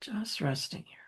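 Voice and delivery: unhurried, softening meditation voice with long pauses; gentle grounding guidance, hushed by the end; guided meditation. Real, not acted.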